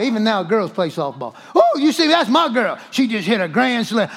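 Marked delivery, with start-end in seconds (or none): 1.5-4.1 proud parent cheering a daughter; excited sideline holler, easing off at the end; shouting. Acted. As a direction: brag to the whole crowd about your girl. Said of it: "Tell me you don't know how to shout".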